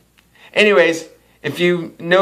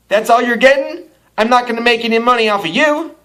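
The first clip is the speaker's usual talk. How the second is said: rude waitress; 7 semitones higher than usual. Retold or imitated. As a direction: imitate a waitress scolding a cheap customer.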